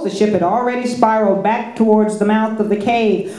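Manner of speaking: ordinary delivery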